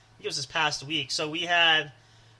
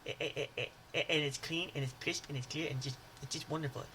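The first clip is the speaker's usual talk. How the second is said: put-on much softer, fast, stammering Water Boy; Water Boy impression; 9 dB quieter, faster than usual. Praising any drink all week in a soft Water Boy voice. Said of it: "I mean".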